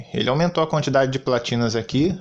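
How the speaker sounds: ordinary talk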